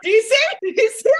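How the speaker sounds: high, loud and pressing; frantic urging. Acted. Co-host acting the character at the door, high excited urging. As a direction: push it like a panicked herald.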